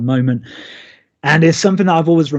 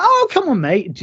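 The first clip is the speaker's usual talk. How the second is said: high-pitched mock protest, swooping up then dropping on "mate"; imitating an over-the-top, pleading complaint; big exasperated reaction; 9 semitones higher than usual. [name] to baby Jack turning away, big hurt reaction. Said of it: "one of those big sort of re-, reactions".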